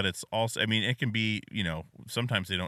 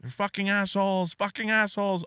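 higher-pitched imitation of a screaming kid; mock child yell; 9 semitones higher than usual. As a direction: retell the kid's screaming without really yelling. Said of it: "He'll just repeat screaming these".

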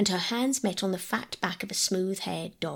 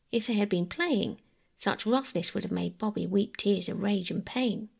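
plain reading voice

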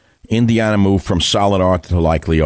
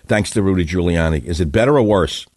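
ordinary talk

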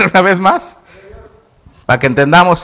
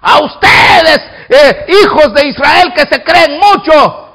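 high, acted giant's taunt; shouted taunt; 8 semitones higher, 6 dB louder, slower than usual. Giant taunting the sons of Israel, shouting. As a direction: holler it scornfully at an army. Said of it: "Oyó que alguien estaba gritando".

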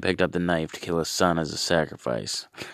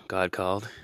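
ordinary delivery

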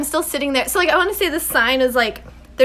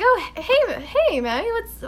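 mock-casual greeting; 8 semitones higher than usual. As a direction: play it innocent to mom, voice pitched up. Child acting casual to crying mom.

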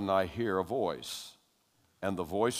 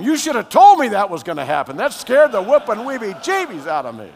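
high, loud, half-laughing reproach; excited loud scolding; 13 semitones higher, 17 dB louder than usual. Retold or imitated. New believer scolds his friend, high excited shouting. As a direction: shout it still shaken.

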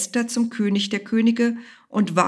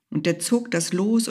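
ordinary delivery

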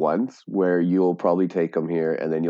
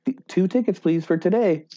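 high, bubbly theme-park greeter voice; cheery cast member; 10 semitones higher than usual. Retold or imitated. Retold Disney worker greeting guests brightly. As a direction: sell the magic, sweet and high. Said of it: "And she's like".

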